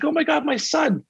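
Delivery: a mother's overwhelmed cry, only hinted; imitating the mom. Retelling a movie scene to the host, mild imitated mother's cry. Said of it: "and his mom's like".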